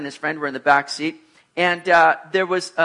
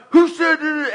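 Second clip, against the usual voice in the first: high, raised-voice confrontation; mock-furious demand; angry challenge; 10 semitones higher, 5 dB louder than usual. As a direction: imitate a guy storming up to fight. Charging stranger acted out.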